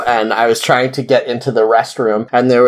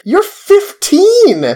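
incredulous yell; 3 dB louder, much slower than usual. Retold or imitated. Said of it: "And I was like".

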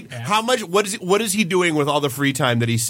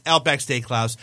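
normal talking voice

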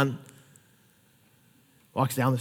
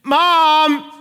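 put-on child calling out; 8 semitones higher, much slower than usual. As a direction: call out helplessly from downstairs like a kid. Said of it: "you hear after five minutes".

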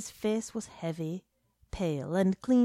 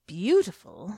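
"Beautiful" drawn out and savoured, louder; lingering on the word; 6 dB louder than usual. A narrator's reading. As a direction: let "Beautiful" hang as you taste the drink. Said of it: "pausing on the word and on the flavour".

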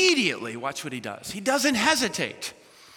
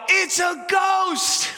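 high, loud, slow frightened cry; retold yell; 10 semitones higher, 4 dB louder, much slower than usual. Disciples in the boat seeing Jesus, drawn-out shout. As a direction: stretch it out like panicked sailors. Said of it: "they yell out".

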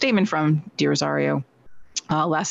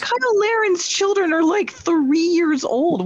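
incredulous; 12 semitones higher, slower than usual. Real, not acted. Incredulous reply to joke.

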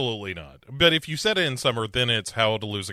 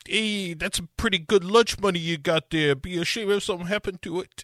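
higher, fast mock tough-guy threat; mock thug; 6 semitones higher than usual. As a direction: play a cartoon bully. Thugs voiced in recap.

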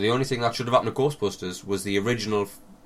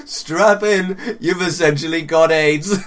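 laughing ad voice; 6 semitones higher, 7 dB louder, slower than usual. Pretend. Ad pitch through laughter.

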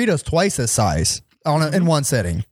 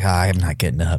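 lazy; 11 semitones lower than usual. Retold or imitated. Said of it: "I was like".

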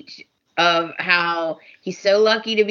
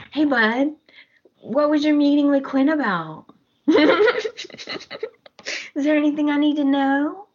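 high, sugary mock-casual prying; hammed-up nosy friend, pitched high; playfully nosy; 7 semitones higher than usual. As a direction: pretend innocence while fishing for gossip. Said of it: "She's all nosy".

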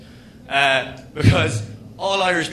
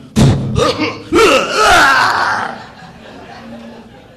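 loud high mocking cackle; evil mosquito cackle; mosquito laughing; 9 semitones higher, 10 dB louder than usual. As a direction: laugh like a gloating insect. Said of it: "the mosquito went".

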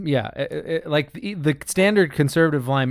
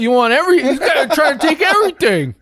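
mock whiny conservative; 9 semitones higher than usual. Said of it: "and be like".

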